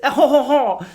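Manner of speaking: high hammed-up French chuckle; mock French accent. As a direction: laugh in a put-on French accent. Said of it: "the French accent is cute".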